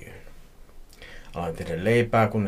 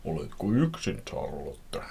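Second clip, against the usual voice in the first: lightly put-on stranger's voice; a hint of a creepy caller; unknown man's voice. A narrator's reading. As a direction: retake the mysterious man on the phone. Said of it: "joku tuntematon miehen ääni".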